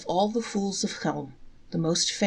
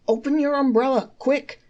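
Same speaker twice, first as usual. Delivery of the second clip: higher, louder urgent call; sudden rain, one fool urges the other; urgent suggestion; 8 semitones higher, 5 dB louder than usual. A narrator's reading. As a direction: hurry him to open it. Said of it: "suggested the one without an umbrella".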